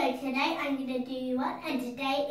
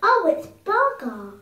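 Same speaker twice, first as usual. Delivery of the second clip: excited; 6 semitones higher, 10 dB louder than usual. Real, not acted. Excited discovery.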